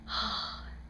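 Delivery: a soft swooning sigh; dreamy whispered sigh. Real, not acted. Fan swooning over the actor, breathy sigh. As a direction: sigh over him like a fangirl. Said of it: "fangirl sigh over actor".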